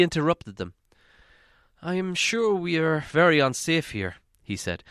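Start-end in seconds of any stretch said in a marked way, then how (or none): 1.8-4.2 worried hobbit voice, raised higher; fretful hobbit fearing he said too much; Bilbo. Acted. Hobbit telling dwarves his worry, in a fretful higher voice.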